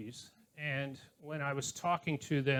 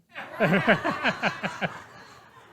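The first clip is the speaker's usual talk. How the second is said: real laugh bursting out, louder; hearty laugh; 5 semitones higher, 7 dB louder than usual. Real, not acted. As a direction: laugh out loud at the memory. Laughing at her own story.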